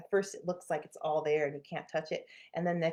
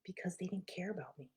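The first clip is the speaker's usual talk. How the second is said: whispered confession; 10 dB quieter than usual. Real, not acted. Confessing her belief.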